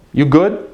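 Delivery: YOU GOOD with GOOD rising sharply; questioning rise on GOOD; literal question. Shown as a demonstration. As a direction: ask "You good?" with a lift on good. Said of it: "now I'm saying literally".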